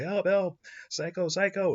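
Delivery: high, loud frightened yelp; panicked cry for help. Retold or imitated. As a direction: squeal it like a man running for his life. Fleeing man cries out.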